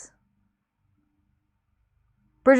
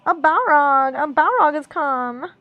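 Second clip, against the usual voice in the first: higher, louder mock wail; Legolas; 4 semitones higher, 5 dB louder than usual. A narrator's reading. Legolas warning of the Balrog, hammed-up wail. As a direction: wail it slowly in dumb blonde voice. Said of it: "wailed Legolas".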